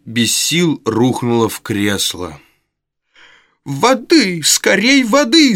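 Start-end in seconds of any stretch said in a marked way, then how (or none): 3.6-5.6 shrieking cry. A narrator's reading. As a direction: screech for water. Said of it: "заверещала Шарлотта". (пронзительный визг)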